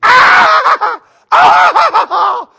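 very high, very loud long scream; mock excited scream. Homeowners see their rebuilt house, hammed-up screaming.

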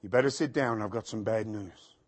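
low, soft and sober; grave bad-news voice. Caller breaks bad news by phone, low hushed voice. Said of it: "We get bad news. The phone rings".

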